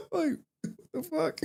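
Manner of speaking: high, slow, laughing what the fuck; laughing disbelief. Real, not acted. Streamer laughing at his obsessed male fans. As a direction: squeal it out through a laugh.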